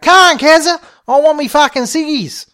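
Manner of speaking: high, very loud holler; calling out. A narrator's reading. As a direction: shout your demand after her. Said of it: "Sheila called out".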